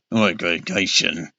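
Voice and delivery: low, slow, clenched-teeth grumble; through clenched teeth. A narrator's reading. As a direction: grit your teeth and mutter it. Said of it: "through clenched teeth".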